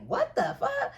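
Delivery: high squeaked what-the-fuck of disbelief; shocked. Retold or imitated. To herself, staring at the fake contact number, shocked high squeak. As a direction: blurt it out in sudden shock.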